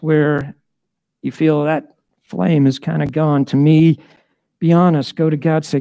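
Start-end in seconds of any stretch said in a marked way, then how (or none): none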